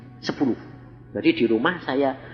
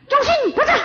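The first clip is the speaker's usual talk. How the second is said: bentakan guru, keras dan tinggi; guru membentak; 8 dB louder, faster than usual. Retold or imitated. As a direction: suruh murid terus baca dengan galak. Guru menyuruh baca dengan keras. (guru membentak murid supaya terus membaca)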